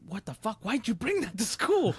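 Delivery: high-pitched, outraged questioning; mock indignant outburst, rising high; imitated angry dad. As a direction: act out an appalled, scolding father. Angry dad confronts kid, retold.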